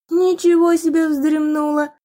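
drowsy, just-woken murmur; sleepy mumble. Acted. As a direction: mumble it half asleep. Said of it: "сонно пробормотала девушка".

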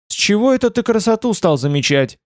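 high-pitched, sarcastic question. A narrator's reading. Woman needling her companion over noticing beauty, sarcastic. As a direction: lift the pitch in mild sarcasm. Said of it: "Она сказала с сарказмом".

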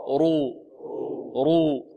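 رو held long with a thick r; dark, heavy r in رو; heavy raa with damma. Shown as a demonstration. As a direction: drill رو slowly for repetition. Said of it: "والمضمومة ما حكمها".

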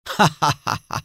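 high, hammed-up villain laugh; loud laugh. A narrator's reading. The unknown rider mocks Nour, high taunting laugh. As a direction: burst into a taunting acted laugh. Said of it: "أطلق ضحكة عالية".

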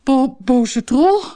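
loud, panicky stammer; stuttering. A narrator's reading. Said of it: "stotterde hij". (luid, paniekerig gestotter)

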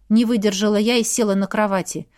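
plain reading voice

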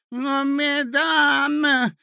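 very high, loud, drawn-out protest; old prince's cry. Acted. Prince interrupting the quarrelling ladies, crying out. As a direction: wail it slowly over the quarrel. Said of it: "закричал князь".

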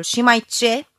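a loud, impatient demand. A narrator's reading. Mother resuming the interrogation, loud impatient demand. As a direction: bark the question at her.

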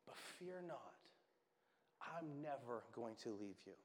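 softly hushed promise; gentle reassurance. Acted. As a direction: reassure someone quietly, letting the voice drop. Preacher voicing Jesus's reassurance.

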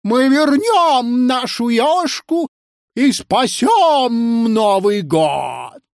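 high, slow rallying proclamation; triumphant orator's call; strengthened, resonant voice. A narrator's reading. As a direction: draw out each word like a grand battle cry. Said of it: "Его голос окреп, набрал обертонов".

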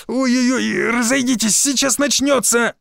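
high wailing cry; stomach cramps. Acted. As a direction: wail as if about to burst. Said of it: "заголосил я".

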